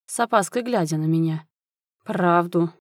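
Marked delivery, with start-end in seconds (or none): 2.0-2.7 terse, mildly menacing growl; understated angry rumble; growled demand. A narrator's reading. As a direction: growl the single word with contained anger. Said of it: "рыкнул я".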